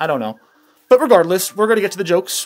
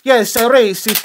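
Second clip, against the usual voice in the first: bad Mexican accent; 3 semitones higher than usual. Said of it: "I'm really bad at a Mexican accent".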